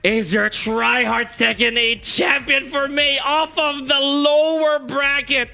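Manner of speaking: slow, very high, loud victory call; champion announcement. Real, not acted. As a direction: announce the winner to the arena.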